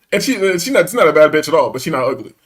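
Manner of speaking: normal talking voice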